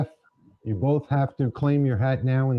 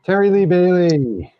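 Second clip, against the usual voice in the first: high, loud announcer call with a falling finish; calling out the winner; 9 semitones higher, 5 dB louder than usual. Real, not acted. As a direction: proclaim the winner's name loudly. Host calls out the winner.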